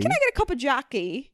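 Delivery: put-on British accent, lifting at the end; playful British impersonation. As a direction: order a drink in mock British. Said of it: "impersonating a British person".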